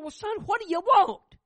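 loud, high, strained shout; fed-up father demanding an answer; exasperated demand. Retold or imitated.

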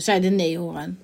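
usual speaking voice

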